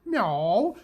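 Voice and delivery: high little meow; cat meow. Retold or imitated. Cat on her back begging for pets, high meow. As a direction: mew up at him for pets.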